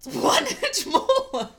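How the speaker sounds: high, slow, laughing golly voice; wholesome 1950s kid. Pretend. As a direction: stretch it out in wide-eyed awe. Playing the 1950s bit.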